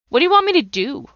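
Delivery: a demand thrown at God; desperate plea. Retold or imitated. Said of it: "I yelled and I screamed".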